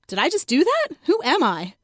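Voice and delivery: ordinary delivery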